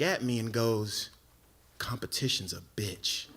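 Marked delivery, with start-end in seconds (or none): 1.8-3.3 low, soft deadpan delivery; cool rival friend. Retold or imitated. Friend coolly claiming the woman he was shown, deadpan. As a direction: drop it coolly, low and understated.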